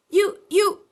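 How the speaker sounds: loud high spluttered stammer; spluttering Piglet accusing Kanga. A narrator's reading. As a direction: splutter through soap. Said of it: "spluttered Piglet".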